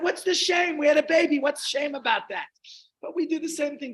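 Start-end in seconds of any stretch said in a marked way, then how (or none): 0.0-2.5 high, loud protesting question; mock indignation. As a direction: retort in exaggerated bafflement. Indignant retort to 'ach, shame'.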